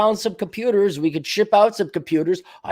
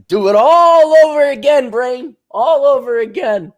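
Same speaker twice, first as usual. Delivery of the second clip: cartoon villain; 8 semitones higher, 6 dB louder than usual. Pinky and Brain parody.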